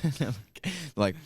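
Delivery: ordinary talk, nothing marked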